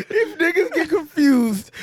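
high voice, laughing through words. Real, not acted. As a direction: laugh while ranting.

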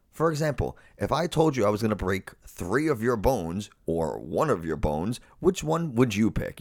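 ordinary talk, nothing marked

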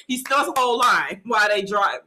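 ordinary talk

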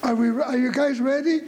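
usual speaking voice

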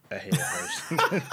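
laughing. Real, not acted. Laughing while repeating hate.

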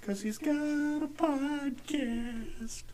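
high and slow cartoon tagline; mock jingle voice. Host capping the bear bit in a high voice. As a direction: finish the joke sing-song.

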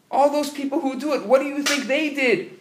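fiery sermon peak; preaching at full force. Acted. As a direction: build it up like a pulpit climax.

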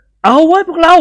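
high, loud battle shout. A narrator's reading. Soldier shouting to friends to hold enemies, loud high shout. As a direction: yell the order to the squad. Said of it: "ร้องตะโกนขึ้นดังๆ".

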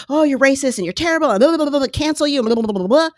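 fast, high, loud mock outrage; mock outraged rant. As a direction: rattle off a piling-on tirade into blahs. Acted outraged online pile-on.

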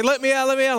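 put-on high, playful child's plea; imitating a child. Son begging from the cupboard, high childish plea. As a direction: squeak it out like a giggling kid.

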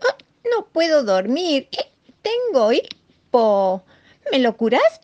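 high little cub voice, hiccuping; hiccuping lion cub. Acted. The little lion cub asking his dad to cure his hiccups. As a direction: squeak it out between hiccups.

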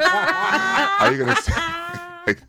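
high drawn-out laughing question. Real, not acted. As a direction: squeal it through laughter. Laughing mock accusation.

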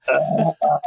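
sudden burst of laughter. Real, not acted. Guest reacting to bad Hollywood Caribbean accents, bursting into laughter.

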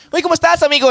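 high-pitched fan greeting; high, gushing mock hello; acted eager greeting. Acts out greeting the celebrity.

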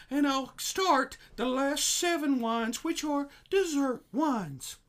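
high, slow, prim lady announcing; the tasting hostess announces to the group, high and slow. Retold or imitated. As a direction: play the fussy wine hostess, slow and high. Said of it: "the lady goes".

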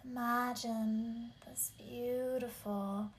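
slow, whispered meditation voice; guided meditation. Acted. Leads grounding meditation.

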